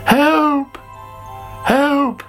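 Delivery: high, panicky storybook cry; high-pitched acted cry for rescue; calling for help. A narrator's reading. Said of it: "called Sammy".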